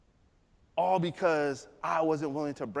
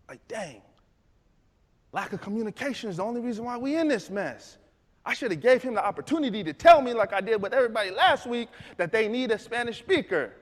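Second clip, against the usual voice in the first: ordinary talk, nothing marked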